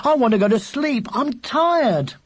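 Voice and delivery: put-on cross, tired boy's complaint; fed up and wanting sleep; angry. A narrator's reading. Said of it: "said William angrily".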